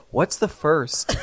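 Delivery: a light, slow Tennessee drawl on the question; slow Tennessee accent. Retold or imitated. Visitor asking the clerk about the largest canyon, slow Tennessee accent. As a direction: innocently ask in a mild Tennessee accent. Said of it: "he's got his little Tennessee accent".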